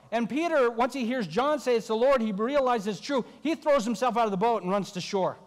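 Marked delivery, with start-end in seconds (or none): none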